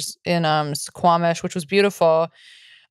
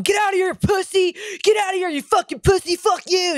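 high-pitched yell without much added volume; mock yelling heckler; 12 semitones higher than usual. Old guy in the corner of a comedy show at people fighting, high mock yell.

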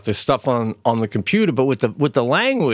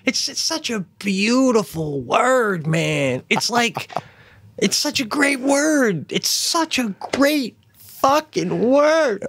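high, slow, dreamy praise of a word; mock savoring; 12 semitones higher, slower than usual. Friend swooning over the F-word, slow and high. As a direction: relish it like poetry.

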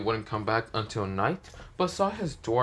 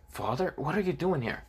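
higher-pitched surprise; lightly acted startled daughter; startled question; 6 semitones higher than usual. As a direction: caught off guard, pitch lifted. Daughter startled by her father's voice.

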